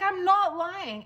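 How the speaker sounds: high, slow, firm I'm not lying; insistent denial. Retold or imitated. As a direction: dig in, make him believe you. Insisting to doubting husband.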